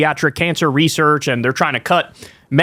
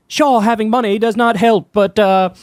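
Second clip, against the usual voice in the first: mock British accent, higher; lightly faked British accent; Russell Brand; 4 semitones higher than usual. Host parodying Russell on money, mock British accent. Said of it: "horrible Russell Brand accent".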